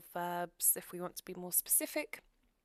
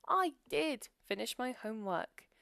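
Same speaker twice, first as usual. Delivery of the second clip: did pressed firmly, a touch louder; emphatic did; 3 semitones higher, 4 dB louder than usual. Shown as a demonstration. Teacher demonstrating emphatic do to students, stressing did. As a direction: lean on did to insist you finished it. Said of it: "So that's an emphatic sentence".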